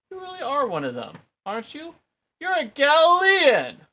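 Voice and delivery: high, loud, rising accusation; excited accusation. A narrator's reading. Bystander to Peter, shrill and rising to a loud cry. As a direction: pin him down as a Galilean, loudly.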